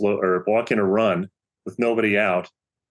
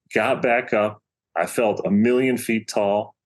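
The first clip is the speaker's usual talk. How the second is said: usual speaking voice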